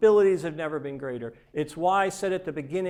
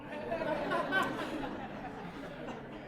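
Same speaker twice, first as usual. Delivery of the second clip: a soft high laugh at his own joke; 11 semitones higher, 10 dB quieter than usual. Real, not acted. Speaker laughing at the joke he just told, soft high laugh. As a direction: chuckle quietly at the punchline. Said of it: "I like the joke".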